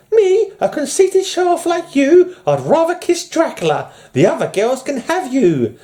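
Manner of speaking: very high mock-female voice; haughty woman in put-on falsetto; lady's voice. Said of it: "I have a lady's voice".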